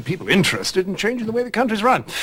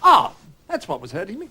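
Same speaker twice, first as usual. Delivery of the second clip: usual speaking voice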